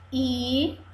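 usual speaking voice

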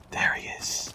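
unvoiced whisper. Real, not acted. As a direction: whisper it to stay hidden. Spotting the enemy.

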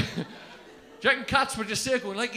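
normal talking voice

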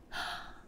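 soft little gasp of wonder; quick acted intake of breath; amazed gasp. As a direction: gasp at a surprising find. Elf finds a big egg.

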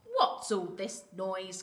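slow, mildly fussy mother hen; lightly voiced hen demanding an answer; clucking hen. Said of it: "clucked Henny Penny".

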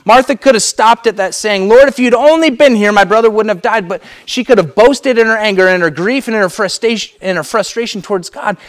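loud, high, impassioned preaching; fervent pulpit voice, pleading with God; preaching at full force. Acted. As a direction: pour it out from the pulpit. Preacher retelling Martha's lament.

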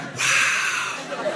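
breathy whispered wow; awed whisper. Retold or imitated. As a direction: whisper it, almost impressed. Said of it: "He's like".

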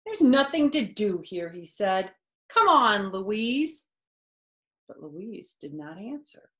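lively storybook voice with character quotes; reading to children. A narrator's reading. Narrator reading a picture book to kids, lively storytelling. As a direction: read it aloud to a child, bright and animated.

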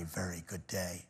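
slow, unvoiced whisper. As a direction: breathe it out tenderly. Tender ending of the story.

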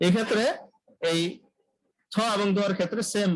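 ordinary delivery

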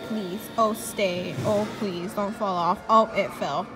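ordinary delivery

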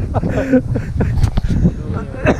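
out-of-breath wheezing laugh; wheezy smoker laugh. Real, not acted. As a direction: laugh with lungs spent after running. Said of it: "That sounded like a smoker".

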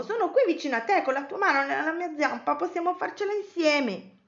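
high, eager reassurance; encouraging. Acted. Cosmo promises to stay beside Betty, encouraging. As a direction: cheer a friend on in a high voice.

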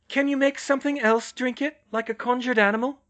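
high, loud, questioning; high female character voice, projected; Hermione's voice. A narrator's reading. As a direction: pitch it high and call it across.

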